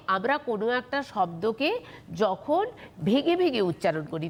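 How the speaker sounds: usual speaking voice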